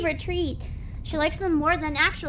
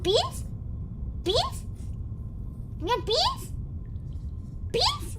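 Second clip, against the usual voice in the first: very high rising beans; high dog-calling voice; 6 semitones higher, 4 dB quieter than usual. Real, not acted. Showing viewers how the dog reacts, high baby-talk question. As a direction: tease the dog with the treat word. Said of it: "Listen, listen to this".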